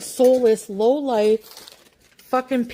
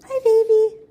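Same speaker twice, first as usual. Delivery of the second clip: cooing greeting; 10 semitones higher, 5 dB louder than usual. Real, not acted. Streamer greets pet at home.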